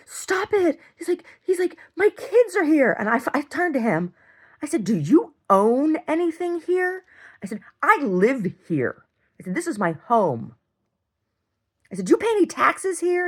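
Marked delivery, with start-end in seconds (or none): 0.1-3.0 high, pleading mimic; mock whiny father. Retelling the guest father's plea, high whiny mimic. 4.8-13.3 heated confrontation. Retold or imitated. Confronting the guest father, higher and louder angry questions.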